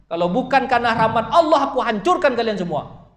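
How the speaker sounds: high, loud divine warning; thundering threat. Acted. Preacher voices God warning the companions, thundering threat. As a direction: proclaim the threat with full force. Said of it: "Wah, seolah begitu".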